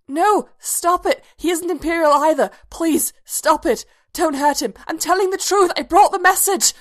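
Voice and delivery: high, loud, desperate begging; screamed pleading. A narrator's reading. Bodhi reliving an interrogation in a nightmare, screamed pleading. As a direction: shout in terror, begging them to stop. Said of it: "And then he screamed".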